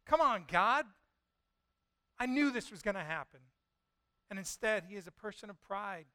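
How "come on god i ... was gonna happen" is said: fed up. Retold or imitated. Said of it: "Jonah's, like, fed up".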